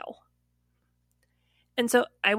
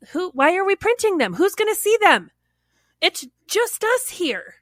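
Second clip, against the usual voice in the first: exasperated; 9 semitones higher, 4 dB louder than usual. Retold or imitated. Questioning coworker about needless printouts, exasperated. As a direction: retell it in mock exasperation, pitch jumping up.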